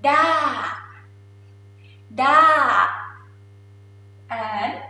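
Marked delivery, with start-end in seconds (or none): 0.0-2.9 "da" starting high, then sliding down long; tired falling tone. Shown as a demonstration. Teacher showing Thai falling tone, tired "da". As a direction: let "da" fall like a tired sigh. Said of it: "I'll show you how tired".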